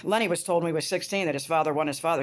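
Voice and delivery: normal talking voice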